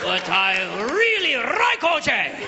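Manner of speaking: slow, high mock Japanese accent; drawn-out Japanese-accented protest; hammed-up Japanese caricature, stretched on "like". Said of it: "All the way to Japan".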